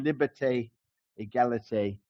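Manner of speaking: "Liberté, égalité, fraternité" in an attempted French accent. Shown as a demonstration. Preacher quoting the French Revolution slogan in a French accent. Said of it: "apologies to any French people for my accent".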